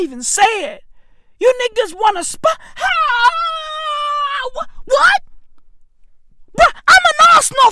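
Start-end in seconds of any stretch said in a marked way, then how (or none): 1.4-7.7 high, wailing fake sob; mock crying. Fan pretending to cry over the Tottenham deal, hammed-up wailing. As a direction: break down in hammed-up tears. Overcome saying the sponsorship.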